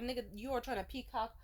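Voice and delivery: ordinary talk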